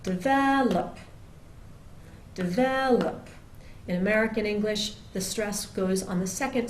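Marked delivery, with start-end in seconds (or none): 0.0-1.0 deVELop, VEL louder and higher; stress on VEL. Shown as a demonstration. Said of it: "words like develop". 2.3-3.3 deVELop, VEL louder and higher; stress on VEL. Shown as a demonstration. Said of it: "words like develop".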